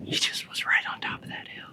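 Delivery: whispering. Real, not acted. Hunters near game, hushed.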